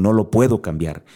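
ordinary talk, nothing marked

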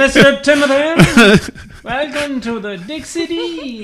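high, slow, drawn-out announcement; hammed-up host's welcome, loud at the start; grand mock welcome. Playing along with the chauffeur bit, grandly hammed-up welcome. Joining in a pretend chauffeur greeting.

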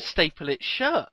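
normal talking voice

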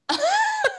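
sudden high laugh; high burst of laughter. Real, not acted. Laughing with her friend about old songs, high laugh. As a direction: let a real laugh burst out. Big laugh after recalling song.

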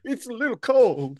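a hint of a pitiful sick boy; mock sick boy. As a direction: whine it like a feverish kid. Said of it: "He's like".

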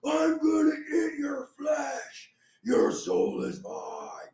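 slow, drawn-out monster roar; demonic yelling. Retold or imitated. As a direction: howl it like a flesh-eating demon. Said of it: "I hear yelling".

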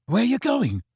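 a high startled question; sudden startled ask. A narrator's reading.

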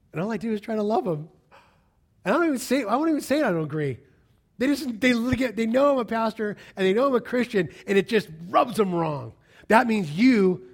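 loud and high, pressing; fervent preaching. Acted. Preacher venting about angry friends.

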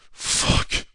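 loud, airy mock curse; dismayed security guards. Acting overwhelmed security guards.

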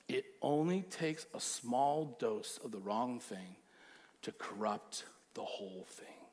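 low, soft and slow, almost hushed; quiet, slow warning. Shown as a demonstration. Pastor hushes the congregation to warn them, slow and soft. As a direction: let the warning sink in quietly. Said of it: "repeated louder".

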